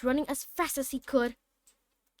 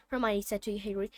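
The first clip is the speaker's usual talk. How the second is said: ordinary delivery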